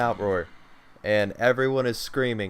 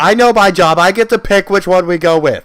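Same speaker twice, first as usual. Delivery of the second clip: bossy; 6 semitones higher, 13 dB louder than usual. Pretend. Playful bossy refusal.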